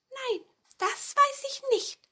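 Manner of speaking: hohes Mäusestimmchen; Maus. A narrator's reading. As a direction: als kleine Maus ganz hoch sprechen. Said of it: "sagte die Maus". (piepsige Mäusestimme)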